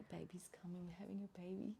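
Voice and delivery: soft breathless whisper of wonder; awed whisper as the baby comes; excited whisper. Retold or imitated. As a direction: whisper it in awe. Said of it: "I was like".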